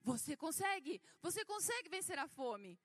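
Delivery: loud preacher impression, easing at the end; Valnice Milhomes. Apostle urges believers to conquer hunger when fasting, imitated forceful preaching. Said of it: "E ela falou assim".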